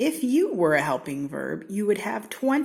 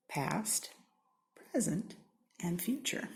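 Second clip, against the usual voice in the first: soft whispered recap; 8 dB quieter than usual. Acted. Narrator to children, recapping the tenses in a whisper. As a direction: whisper the three tenses like a secret.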